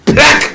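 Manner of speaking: sudden high-pitched "plak"; cartoonish blow noise; high smack sound. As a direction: imitate a whack, high and short. Imitated blow in story.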